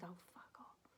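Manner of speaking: whispered, barely voiced; mumbled swear. Recalling the judge's mutter, whispered. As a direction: breathe it out like a hidden mutter. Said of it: "he's mumbling".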